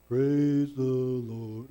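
low, very slow, deadpan praise; mock dull praise. Mocking stiff worship, praising the Lord low and very slow. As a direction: drag it out like a bored pew-sitter. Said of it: "Sit still like this and go".

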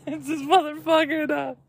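high, laughing disbelief. Real, not acted. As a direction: squeeze the words out through laughter. Laughs at the absurd tweet.

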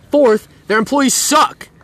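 slow, deliberate final point; slow emphatic. Real, not acted. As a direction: land the last grievance slowly. Listing Rite Aid complaints.

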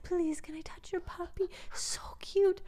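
put-on high, soft, pleading little boy; little French kid. Imitating a small boy begging to pet the puppy, high and soft. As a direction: beg sweetly like a small child. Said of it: "he's like".

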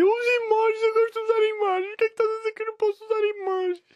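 put-on very high squeaky baby voice; whining baby. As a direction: whine like an indignant baby. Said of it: "Ele deve estar a dizer".

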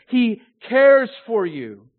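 cares loud, high and drawn out; slow, forceful line landing on cares; stress on cares. Shown as a demonstration. Preacher awed by that majestic and powerful being, stress on cares. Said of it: "we could emphasize care".

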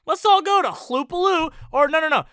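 high, loud sing-song invitation; rally everyone to Hloopaloo; mock cheer. Mock announcement.

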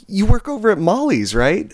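high, eager imitated questioning; eager customer. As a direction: play an overeager stranger sure he knows you. Retells the excited customer's question.

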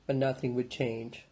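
ordinary delivery, nothing marked

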